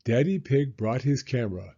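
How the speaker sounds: ordinary delivery, nothing marked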